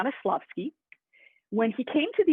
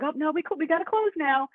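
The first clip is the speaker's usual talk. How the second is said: higher-pitched, lightly acted storekeeper brush-off; nervous storekeeper; 8 semitones higher than usual. Storekeeper trying to get this guy out, mildly nervous. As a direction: lightly play a clerk making excuses.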